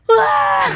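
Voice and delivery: sudden high shocked yelp; a kid's startled cry. Retold or imitated. Son startles finding a G-string, high yelp.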